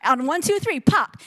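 mock shout. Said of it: "he said".

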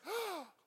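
a sharp, high shocked gasp; imitated church gasp. The congregation gasps when a convert curses, high gasp. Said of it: "and you heard this".

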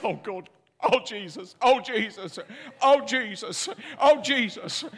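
mock agony after a crushed toe; retold pain moans. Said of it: "All I could say was".

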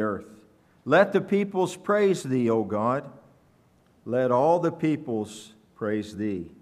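deliberate psalm reading in spaced phrases; scripture reading. Acted. Pastor reading the psalm to the congregation, scripture reading. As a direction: proclaim each phrase, then let it rest.

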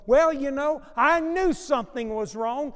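loud, high, regretful mourner; mourner's regret. Retold or imitated. Preacher imitating friends after a suicide, loud and high. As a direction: wail it out like a grieving friend who missed the signs.

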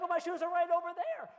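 loud, high protest; whiny kid protest. Retold or imitated. Kid protesting to dad, loud and whiny. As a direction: whine back loudly. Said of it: "they're like".

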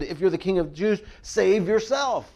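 high, loud taunt stretched out at the end; mocking. A narrator's reading. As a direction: jeer it, dragging out the last words. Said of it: "they mocked him".

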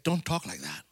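low, soft warning; hushed Peter. Retold or imitated. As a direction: murmur it as a rebuke. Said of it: "Peter is saying".

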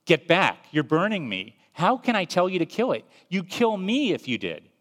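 a scared ghost crying out at the burning angel. Acted. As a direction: shout him back in alarm. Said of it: "the ghost cries out".

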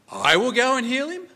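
"I" hit loud, then pitch climbing high into a question; stress on I, questioning. Shown as a demonstration. Jesus replying to the centurion's request, questioning stress on "I". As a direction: ask it incredulously, leaning hard on "I". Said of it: "the emphasis is on the word".

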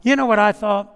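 ordinary delivery

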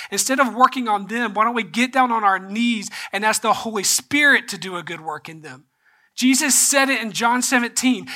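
impassioned preaching. Acted. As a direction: press the point to the church. Preaching to congregation.

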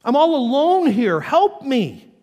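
loud plea for help; honest cry. Acted. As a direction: cry out plainly to God. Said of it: "Why not say".

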